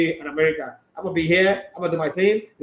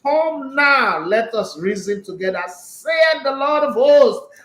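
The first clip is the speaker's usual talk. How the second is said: higher, louder, slow preacher's quote; mock sermon quoting the Lord; preaching voice; 6 semitones higher, 4 dB louder, slower than usual. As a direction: proclaim it like a pulpit verse. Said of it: "Even God said".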